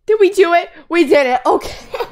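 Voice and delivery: ordinary delivery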